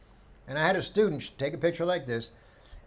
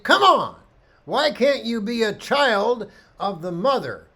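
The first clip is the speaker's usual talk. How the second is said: loud, high exasperated cry at the animals; a frustrated shout that pleads with them; cursing at the giraffes; 7 semitones higher, 7 dB louder, slower than usual. Retold or imitated. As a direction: yell at the giraffes, fed up and pleading. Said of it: "I was cursing this photograph".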